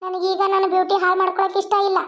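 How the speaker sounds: ordinary talk, nothing marked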